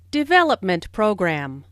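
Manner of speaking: Development stressed, program said lighter. Shown as a demonstration. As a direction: hit Development in the compound. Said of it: "the main stress falls on development".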